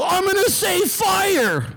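high, slow mock holler; drawn-out high-pitched mock shout; mock pessimist. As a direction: wail it out as a useless alarm-raiser. Mocks useless pessimist answering questions.

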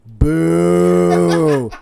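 loud high playful boo; mock booing. Real, not acted. Listener boos the dad joke loudly. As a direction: boo the bad pun.

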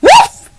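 high, sudden imitated woof; dog bark. A narrator's reading. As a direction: bark once, pitched right up. Dog barks on finding Louise.

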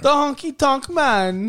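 put-on high, slow, stretched Italian-ish accent, clumsy; Santino Marella accent. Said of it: "how do you say it?".

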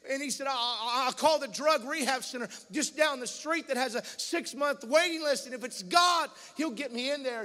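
high, very loud, sustained testimony; full-force preaching. A narrator's reading. Preacher telling a congregation of an addict's rescue, full-force preaching. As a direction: drive the story home at full voice.

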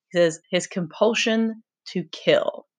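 "compulsion to kill" slowed and weighted; stress on compulsion. Shown as a demonstration. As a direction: lean on "compulsion to kill" slowly. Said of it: "Notice the fact that".

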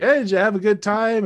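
fishing for a yes; hopeful question. Retold or imitated. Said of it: "I'm like".

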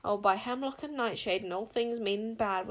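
ordinary delivery

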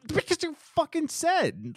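high-pitched, drawn-out exasperation; slow, squeezed-high frustrated retort. Retold or imitated. Retelling a retort to the boss, frustrated. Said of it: "I got so frustrated".

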